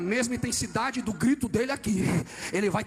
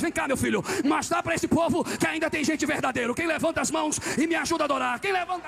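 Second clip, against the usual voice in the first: raised, urgent pulpit cry; full-force preaching; 7 semitones higher, 4 dB louder than usual. Real, not acted. Preacher decrying today's pulpits and calling for raised hands, fervent. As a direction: press higher and drive the congregation to respond.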